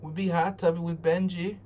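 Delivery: mock hype voice; hammed-up goofy voice. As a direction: play it up as a goofy bro. Sing-song joke about hot tub.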